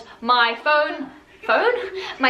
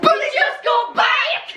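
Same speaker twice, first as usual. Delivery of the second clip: shout; 11 semitones higher, slower than usual. Real, not acted. Shouting at the film.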